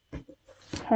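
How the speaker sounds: soft breathy whisper; whispered hey. Real, not acted. Speaker whispering a tender hello to viewers. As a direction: lean in and whisper it. Affectionate sign-off.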